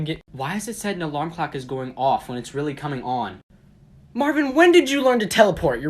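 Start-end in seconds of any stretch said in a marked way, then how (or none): 4.1-5.8 startled. Real, not acted. Marvin suddenly appears.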